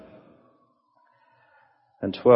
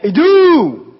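sudden high ringing cry of the word; excited proclamation; 16 dB louder than usual. Acted. Preacher crying out the Greek word to his congregation, ringing and exultant. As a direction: burst out with it, full of wonder.

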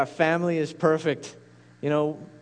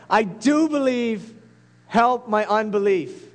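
a louder, pleading cry with "do" leaned on; desperate cry; 5 semitones higher, 4 dB louder than usual. Acted. Preacher retelling the father crying out to Jesus, louder plea. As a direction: beg for help, voice lifted. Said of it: "cries out".

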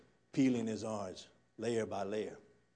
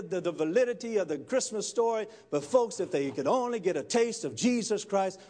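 loud, raised-pitch sermon delivery; full-force preaching; 7 semitones higher, 5 dB louder than usual. Real, not acted. Preacher urges the church to share Jesus, impassioned. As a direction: drive the message home from the pulpit.

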